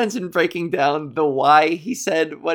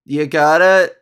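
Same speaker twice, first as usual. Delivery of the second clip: ordinary talk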